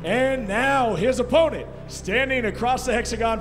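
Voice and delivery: big booming fight-night introductions; boxing ring announcer. Acted. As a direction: stretch the names like a ring announcer.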